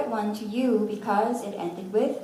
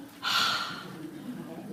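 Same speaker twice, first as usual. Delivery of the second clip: heavy, breath-only "ha"; overdone H as a long unvoiced puff; exaggerated H sound; 5 semitones lower, 6 dB quieter than usual. Shown as a demonstration. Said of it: "no one talks like that".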